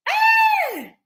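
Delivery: very high, loud held squeal of hello. Real, not acted. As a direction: squeal a long, high greeting. Shrieked greeting.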